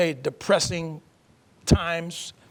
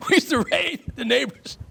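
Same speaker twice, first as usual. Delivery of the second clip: higher-pitched, amused, just after a laugh; amused confession; 8 semitones higher than usual. Real, not acted. Guest confesses boyhood mischief to the host, amused. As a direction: chuckle your way into it.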